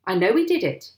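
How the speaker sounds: loud accusing outburst; heated accusation; indignant cry. A narrator's reading. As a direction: insist at full voice. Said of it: "cried Mister Hazel".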